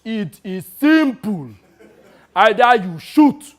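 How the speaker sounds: mock African soldier's stern order; African soldier impression. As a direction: bark it like a hardened African soldier. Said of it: "in his best impression of an African soldier".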